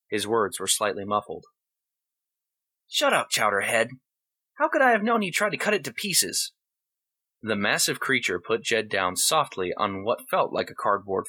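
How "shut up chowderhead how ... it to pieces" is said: put-on high, annoyed kid voice; a bratty kid shooting back; boy snapping back. Boy snaps at tiger.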